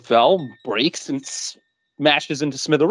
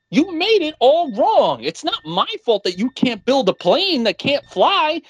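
a little sister twisting the blame onto her brother; higher pouty four-year-old whine; whiny kid sister; 9 semitones higher than usual. Retold or imitated. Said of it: "she's like".

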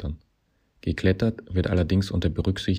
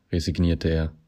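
plain reading voice

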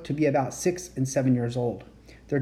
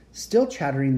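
ordinary delivery, nothing marked

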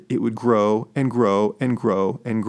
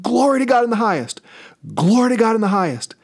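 high, fast proclaiming cry; ringing, high angelic proclamation; angel army shout; 7 semitones higher, faster than usual. Retold or imitated. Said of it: "shouting out".

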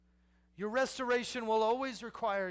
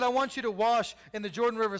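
commanding call; 3 semitones higher, 7 dB louder, faster than usual. Acted. Prophet's command quoted.

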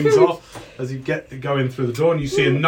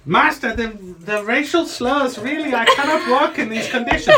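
high, protesting gnome servant; gnomey voice; 9 semitones higher than usual. Acted. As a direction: object to your master as a little gnome. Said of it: "your little gnomey voice".

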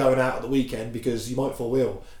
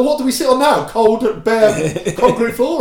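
put-on kids complaining; 8 semitones higher, 5 dB louder than usual. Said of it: "Now they're like".

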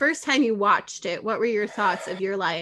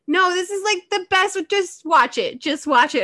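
higher, louder pushy insistence; excited friend pushing her to keep watching; insistent urging; 8 semitones higher, 4 dB louder than usual. Retold or imitated. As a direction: urge her on, won't take no.

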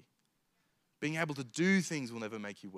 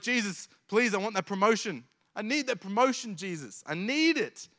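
higher, pushy, needy pleading; eager begging prayer; 6 semitones higher, 3 dB louder than usual. Retold or imitated. Job seeker pressing Jesus for a promotion, eager and high. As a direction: beg for it like you can't wait.

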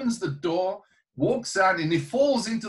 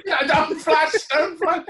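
high, louder, laughing echo; 12 semitones higher, 6 dB louder, slower than usual. Real, not acted. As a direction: repeat it cracking up. Laughing, echoing friend's joke.

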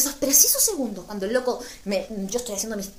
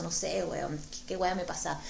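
ordinary talk, nothing marked

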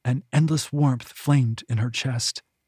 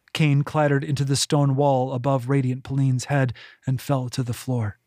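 plain reading voice

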